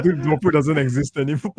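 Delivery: ordinary talk, nothing marked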